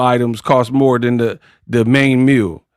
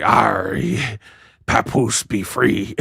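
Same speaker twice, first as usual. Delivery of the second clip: slow, low, softer pirate voice; mock pirate; 4 semitones lower, 5 dB quieter, slower than usual. Boxer insisting her man is free, mocked as pirate. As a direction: claim it in a hammed-up pirate drawl. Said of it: "You're like".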